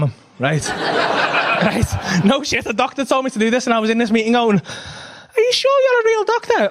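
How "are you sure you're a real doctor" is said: high, slow disbelieving question; incredulous. Retold or imitated. Father in a therapy meeting asking incredulously. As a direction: sound amazed she's a doctor.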